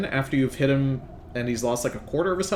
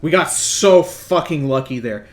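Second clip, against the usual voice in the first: excited relief; 3 semitones higher, 9 dB louder than usual. Real, not acted. Relief after surviving.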